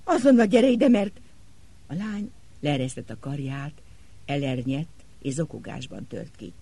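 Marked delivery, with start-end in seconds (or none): 0.0-1.2 loud, high, urgent command; loud urgent summons; commanding call. Acted. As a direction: order her over, loudly. Doctor commanding distraught girl.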